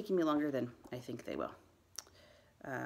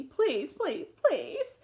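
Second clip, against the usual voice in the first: very high, loud repeated begging; pleading cry; 12 semitones higher, 8 dB louder, much slower than usual. A narrator's reading. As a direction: plead harder on each please. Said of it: "cried Toby".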